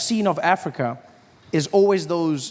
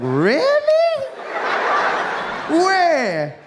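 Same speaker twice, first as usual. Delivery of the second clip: mock astonished; 12 semitones higher, much slower than usual. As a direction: feign total shock.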